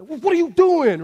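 high, loud, drawn-out dad yell; retold yell. Dad yelling at misbehaving boys, loud and exasperated. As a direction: re-enact an angry parent's outburst. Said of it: "I yelled. I was like".

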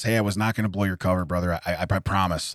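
low, soft, quick reassurance; confiding. Retold or imitated. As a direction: keep it hushed, man to man. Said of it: "like that".

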